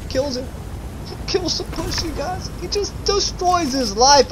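very high, strained mock dismay; dismayed squeak. Player telling viewers his bushy friend got killed, high dismayed squeak. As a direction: squeak it out in disbelief.

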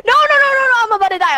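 high, crying mock panic; high panicked yelping; playful panic. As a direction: wail in game panic, easing as it goes. Character about to die.

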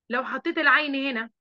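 ordinary delivery

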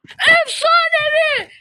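high, slow, stretched-out shout of joy; ecstatic yell. Real, not acted. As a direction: scream "Efsanevi" in disbelief. Legendary drop appears. (efsanevi çıkınca çığlık atan oyuncu, coşkulu bağırış)

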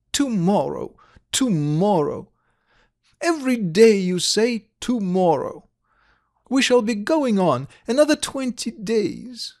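put-on high, cross child's voice; exasperated little girl. A narrator's reading. Little girl fed up with her father's promises, exasperated.

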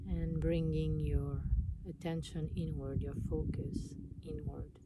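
calm meditation voice with long pauses; guided meditation. Acted. Guiding listeners to relax and notice their breath, meditation voice. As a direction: guide them inward, unhurried, leaving space between phrases.

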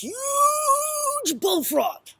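"huge" stretched very long and high; hammed-up, elongated "huge"; stress on huge. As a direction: draw out "huge" in a loud, high swoop. Storyteller exaggerating the frog's size.

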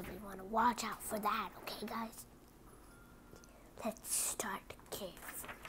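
soft breathless whisper. Acted. Reading to kids, cautious whisper. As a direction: tiptoe into it with a whisper. Cautious start of tricky book.

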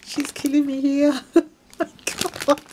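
high, laughing disbelief; overwhelmed laughter. Real, not acted. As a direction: break into laughter while gushing. Overwhelmed, laughing at friend's generosity.

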